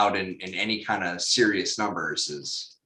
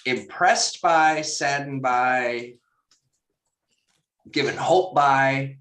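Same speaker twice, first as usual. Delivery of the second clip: slow weighing; 3 semitones higher, slower than usual. Real, not acted. Listing mixed emotions slowly.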